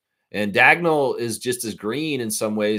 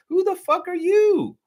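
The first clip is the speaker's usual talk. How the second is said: mock confrontation, voice cracking high; indignant outburst; 9 semitones higher than usual. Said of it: "That's what I would say".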